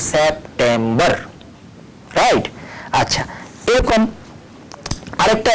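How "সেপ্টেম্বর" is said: lower, slow, joined syllables; slow whole-word demo. Shown as a demonstration. Teacher joining the syllables slowly for students. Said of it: "পুরো ওয়ার্ডটাকে একসঙ্গে উচ্চারণ করতে পারবো".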